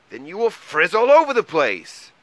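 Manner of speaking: loud, high exasperated outburst; angry cry. A narrator's reading. Mole fed up with Troll's frizz worries, crying angrily. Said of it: "cried Mole angrily".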